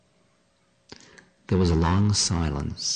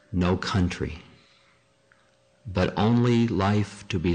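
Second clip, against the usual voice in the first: spaced phrases with long pauses; deliberate teaching read-aloud; 3 semitones higher than usual. Acted. Teacher reading Don Juan to listeners, deliberate. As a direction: let each phrase land before the next.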